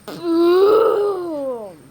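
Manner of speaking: loud drawn-out kaboom, rising then falling; play-acted nuclear boom; imitated explosion. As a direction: voice a big blast, long and loud.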